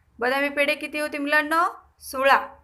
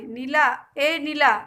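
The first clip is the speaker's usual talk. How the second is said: louder storyteller's call; 5 dB louder, slower than usual. A narrator's reading. Mother calls elder sister Neela, mild calling voice. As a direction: call a child in, lightly acted. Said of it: "ताईला हाक मारली".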